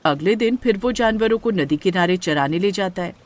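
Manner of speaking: usual speaking voice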